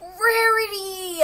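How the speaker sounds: very high, loud, stretched call; shrill call. Acted. Pony calls out for Rarity, very high and loud. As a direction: yell her name across town.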